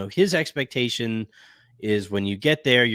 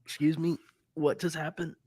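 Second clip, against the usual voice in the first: acted double take, higher and softer toward the end; mock disbelief; 3 semitones higher, 6 dB quieter than usual. Both hosts hearing the news hours ago, mock disbelief. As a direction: play stunned confusion. Acts out their surprised reaction.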